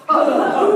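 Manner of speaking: very loud, high breathy "uh"; explosive belly grunt; getting-hit grunt. Shown as a demonstration. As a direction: blast the air out as if punched. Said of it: "Pretend you're getting hit".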